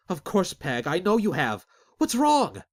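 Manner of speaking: building from calm to high, loud alarm; growing fear breaking on the last words; rising panic. Acted.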